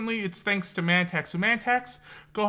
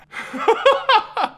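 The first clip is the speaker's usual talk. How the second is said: high, loud laugh; 6 dB louder than usual. Real, not acted. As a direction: burst out laughing. Laughs at shout-out joke.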